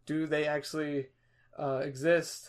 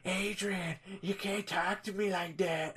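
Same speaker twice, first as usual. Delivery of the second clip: Adam Sandler voice; 5 semitones higher, 5 dB quieter, slower than usual. Retold or imitated. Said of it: "he's like".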